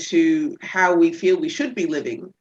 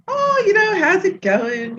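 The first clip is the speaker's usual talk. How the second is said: breezy fake casual; 11 semitones higher, 3 dB louder, slower than usual. Sneaking over to chat breezily.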